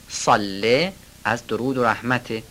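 ordinary delivery

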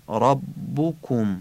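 ربکم slowly, with full rounded "u" in both short vowels; Arabic damma vowels. Shown as a demonstration. Teacher showing learners Arabic vowels in ربکم, slow rounded damma. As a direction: draw out ربکم as rab-bu-kum, Arabic u sounds. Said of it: "ضمه ها رو هم باید عربی بگیم".

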